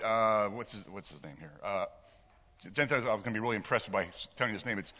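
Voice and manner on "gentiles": ordinary delivery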